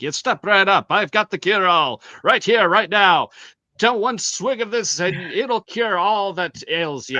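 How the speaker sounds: loud, booming snake oil hawker; carnival barker voice. Retold or imitated. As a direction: step right up, sell the cure-all. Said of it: "I was trying to go for Carney".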